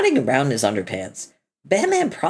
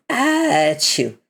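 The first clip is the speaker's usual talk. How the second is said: fake sneeze; 4 semitones higher, 3 dB louder than usual. Mock sneeze bit.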